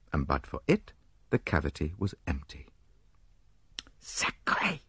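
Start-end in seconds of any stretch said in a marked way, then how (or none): 4.0-4.8 hushed, high French oath; a touch of anger, hissed out softly; angry Poirot. A narrator's reading. Poirot finds the cavity empty, a hushed angry oath. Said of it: "cried Poirot angrily".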